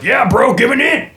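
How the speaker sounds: hyped dap greeting. Retold or imitated. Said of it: "He goes like".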